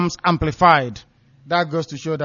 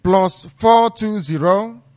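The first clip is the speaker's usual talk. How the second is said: digits spaced and drawn out; slow repeated number; 4 semitones higher, 3 dB louder, much slower than usual. Shown as a demonstration. Announcer repeating the code to callers, slow. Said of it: "I repeat".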